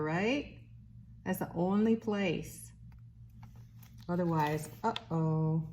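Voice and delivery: bright sing-song read-aloud voice; reading to a toddler. Acted. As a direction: read it like to a little one. Reads a picture book to baby.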